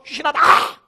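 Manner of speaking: 귀신 쫓는 제자의 큰 고함 흉내; 호통치는 흉내. Retold or imitated. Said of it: "소리소리 버럭버럭 지르면서". (버럭 소리 지르며 귀신 쫓는 흉내)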